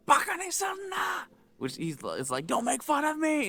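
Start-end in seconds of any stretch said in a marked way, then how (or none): 0.1-1.2 slow, high mock shout; retold furious yell, pitched way up; imitated screaming. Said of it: "he's screaming". 2.5-3.5 high-pitched mock shout; retold outburst, pitched way up; imitated angry yell. Acting the man's angry shout.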